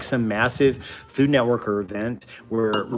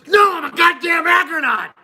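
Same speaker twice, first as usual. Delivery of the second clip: much higher, loud mock yell; hammed-up furious shout; 7 dB louder than usual. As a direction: roar it like an offended conqueror. Playacting the agronaut character.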